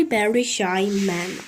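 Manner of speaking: ordinary delivery, nothing marked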